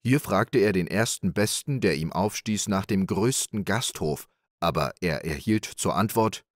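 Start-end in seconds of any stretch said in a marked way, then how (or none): none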